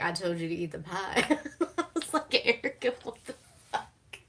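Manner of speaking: talking through real laughter; cracking up. Real, not acted. Fan retells the pie scene, cracking up. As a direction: let the laugh break up the words.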